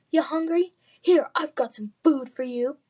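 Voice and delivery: mocking bully.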